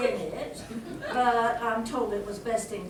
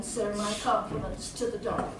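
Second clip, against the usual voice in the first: ordinary delivery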